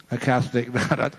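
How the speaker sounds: usual speaking voice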